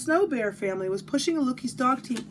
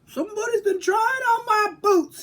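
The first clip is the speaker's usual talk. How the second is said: higher and louder storybook bear boom; big bear voice boom; 10 semitones higher, 6 dB louder than usual. Acted. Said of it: "he boomed in his big bear voice".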